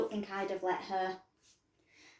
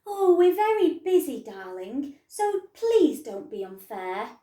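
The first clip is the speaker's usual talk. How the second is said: higher, louder, breezy busy-parent voice; busy mum; 4 semitones higher, 9 dB louder, slower than usual. Acted. Busy mum putting off her excited daughter, breezy. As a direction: brush the child off sweetly.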